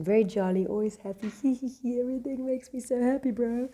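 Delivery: high, giggly over-happy guy; jolly person. Retold or imitated. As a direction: play the always-happy type. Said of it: "That's the one kind of person".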